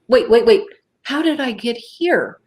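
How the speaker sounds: retold bewilderment. Witness finding herself in front of the car, bewildered. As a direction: halt yourself, then ask in high confusion. Retells sudden confusion by the car.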